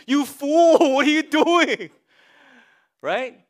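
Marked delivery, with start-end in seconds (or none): 0.1-1.9 high, loud, laughing rebuke; mock loud scolding. Counselor scolds forgetful husband Billy, loud and high. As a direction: shout him down playfully with a laugh. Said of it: "I say to him".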